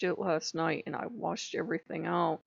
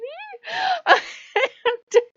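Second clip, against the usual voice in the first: real squealing laugh, much higher and louder; high laughter; 7 dB louder than usual. Real, not acted. Laughing after admitting maybe, high squealing laugh. Laughing at her own answer.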